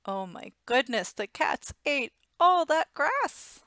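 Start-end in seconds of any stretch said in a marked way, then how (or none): none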